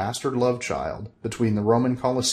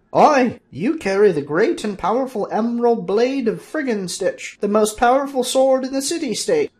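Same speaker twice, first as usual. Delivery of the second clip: high, loud wizard boasting grandly; Fezzer's voice; 14 semitones higher, 5 dB louder than usual. Acted. Fezzer telling Bob of his sword, high grand wizard voice. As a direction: build up the sword's glory.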